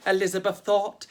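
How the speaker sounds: ordinary delivery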